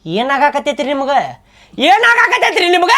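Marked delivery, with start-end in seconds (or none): 1.9-2.9 high, insistent questioning; raised voice. Acted. Friend presses the silent mother for answers, raised voice. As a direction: raise your voice and demand an answer. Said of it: "ಅವಾಜ್ ಮಾಡಿ ಕೇಳಿದ್ರ".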